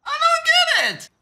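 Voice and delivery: high, slow mock wail of confusion; mock despairing wail.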